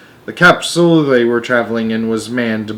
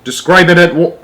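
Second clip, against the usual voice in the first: loud, higher commanding voice; order; 6 semitones higher, 6 dB louder than usual. Acted. Ground control commands Shuckworth, ordering loudly. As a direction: bark the order. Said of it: "ordered ground control".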